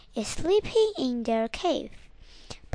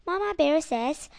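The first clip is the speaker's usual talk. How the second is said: ordinary delivery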